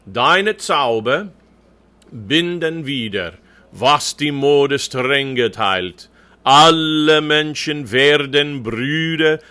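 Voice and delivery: measured, drawn-out German recitation, firm and clear; slow diction model. Shown as a demonstration. Coach reading the whole verse for the choir, slow careful diction. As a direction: recite the verse slowly, every consonant placed. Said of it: "I'll be doing some reading of the text".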